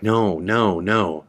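usual speaking voice

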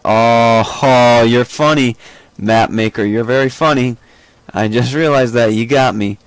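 loud, drawn-out "oh, very funny"; loud sarcastic complaint at being fooled; sarcastic mock indignation. Real, not acted. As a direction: loudly call out the prankster. Tricked by the map maker.

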